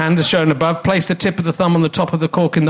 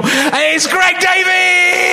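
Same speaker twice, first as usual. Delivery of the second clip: high, drawn-out shouted introduction; big guest intro shout; 10 semitones higher, much slower than usual. Real, not acted. Host introducing the guest to the theatre, long shouted welcome. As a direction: roar the guest's name to the crowd.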